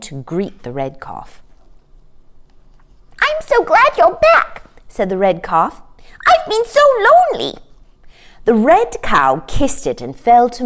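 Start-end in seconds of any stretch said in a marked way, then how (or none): 3.2-4.6 put-on much higher, small child-like voice; a lonely little calf, glad and high; red calf voice. A narrator's reading. Calf greeting its returning mother: high little voice. 6.3-7.5 put-on much higher, small child-like voice; a lonely little calf, glad and high; red calf voice. A narrator's reading. Calf greeting its returning mother: high little voice.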